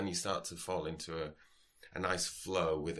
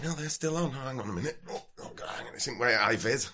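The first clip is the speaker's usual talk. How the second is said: hammed-up sozzled Yorkshire lad; drunk Yorkshireman; 5 semitones higher than usual. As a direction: drunk Yorkshire festival-goer demanding a wristband. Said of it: "determined slasher from Yorkshire".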